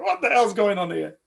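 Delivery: loud, high outraged challenge; really angry. Retold or imitated. Girlfriend seeing strangers in the car, angry. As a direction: imitate her demanding an explanation. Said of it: "she looked really angry".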